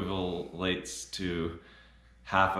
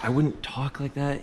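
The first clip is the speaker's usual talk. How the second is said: exasperated protest; 7 semitones higher than usual. Real, not acted. Protesting mid take.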